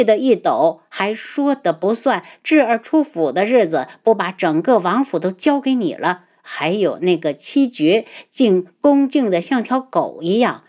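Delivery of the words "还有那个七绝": usual speaking voice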